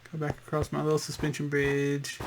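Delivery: ordinary delivery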